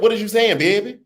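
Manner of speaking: lightly put-on New Orleans accent, playful; a touch of home New Orleans accent, amused. Shown as a demonstration. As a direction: say What is you saying in a New Orleans accent, teasing. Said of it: "What is you saying in my New Orleans accent?".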